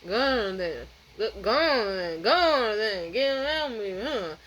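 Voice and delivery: slow, slurred put-on voice; slow man with attitude. Man blocking store line waving customer past, slow attitude. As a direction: drag it out, dim and surly. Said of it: "with an attitude, or slow as fuck".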